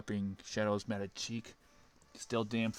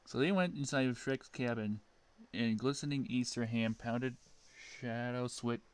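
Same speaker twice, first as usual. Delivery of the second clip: plain reading voice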